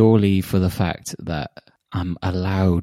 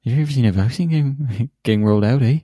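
higher, quick Canadian parody; mock Canadian accent; 5 semitones higher, 5 dB louder, faster than usual. Shown as a demonstration. Co-host showing how she should sound, mock Canadian accent. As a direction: exaggerate the Canadian lilt up to "eh". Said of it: "Not that she speaks like that".

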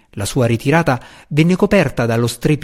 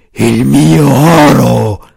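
slow, loud dragon moan, drawn out; a dragon wailing over its gold; gemito del drago; 8 dB louder, much slower than usual. A narrator's reading. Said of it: "gemeva il drago". (gemito lento e forte di drago)

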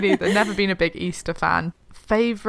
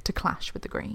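whispering; 11 dB quieter than usual. Real, not acted. Whispered afterthought.

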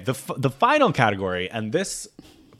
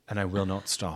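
calm threat; 3 semitones lower, 6 dB quieter than usual. Shown as a demonstration. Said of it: "kind of calmly too".

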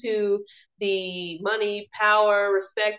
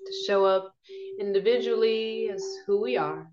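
guided meditation; 5 dB quieter than usual. Acted. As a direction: ease them gently into calm.

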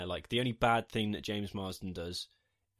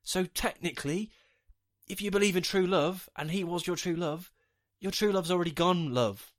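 high, loud exasperated rant; 8 semitones higher, 4 dB louder than usual. Retold or imitated. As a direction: argue it, fed up and incredulous. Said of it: "I was like".

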